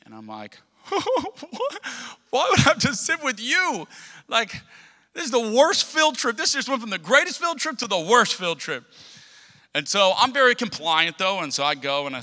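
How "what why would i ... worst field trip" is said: high-pitched mock outrage of a sixth grader; acted-out childish dismay, pitched way up; upset protest. Child told to sit with teacher, high dismayed protest.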